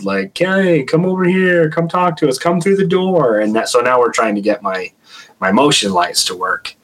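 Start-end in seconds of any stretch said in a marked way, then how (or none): 0.4-3.4 higher, mild coaxing call to a spirit; very mild invitation. Retold or imitated. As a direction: sweetly lure it through the door. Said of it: "very mild".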